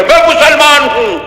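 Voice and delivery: mock proud claim. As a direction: puff up a little as you say it. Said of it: "بڑے فخر سے کہہ رہے".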